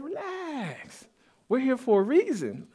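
high, soft, drawn-out reassurance; mock soothing elder. Preacher acting the elder telling John to relax, playfully soothing. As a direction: stretch it out high and light to calm someone.

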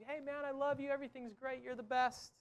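high, soft, sugary praise voice; mock flatterer. Preacher mimicking a flatterer's sweet talk, high and soft. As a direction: coo the empty compliments like a flatterer.

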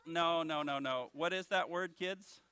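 ordinary delivery

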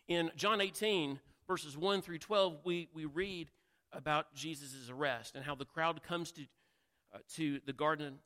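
ordinary talk, nothing marked

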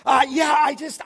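loud, high eager outburst; fired-up shout, pitched high. Retold or imitated. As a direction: imitate a man raring to go. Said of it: "I think he was fired up".

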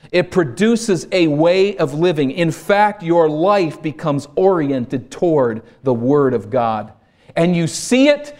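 preacher lifting the crowd toward the word; building preaching. Real, not acted. Preacher urging the congregation, slow rising preaching.

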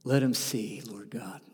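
1 hushed prayer. Acted. Jesus praying for Peter.

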